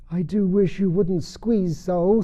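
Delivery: a squeezed little animal complaining; squashed Dormouse. A narrator's reading. Dormouse complains to Alice, slow and high. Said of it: "said the Dormouse".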